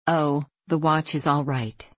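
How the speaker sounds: plain reading voice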